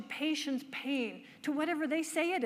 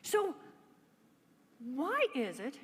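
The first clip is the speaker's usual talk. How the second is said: ordinary talk, nothing marked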